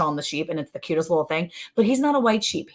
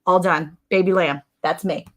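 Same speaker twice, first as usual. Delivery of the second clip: ordinary talk, nothing marked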